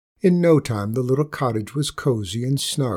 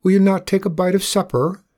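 higher, louder call after him; 7 semitones higher, 5 dB louder than usual. A narrator's reading. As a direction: call across the room to him. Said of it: "Mattie called".

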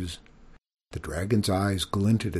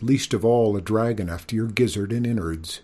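ordinary delivery, nothing marked

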